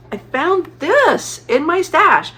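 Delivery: high, loud and slow with delight; excited reveal. Real, not acted. Knitter revealing stash yarn to viewers: delighted, drawn-out exclamation. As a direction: show off the lucky find.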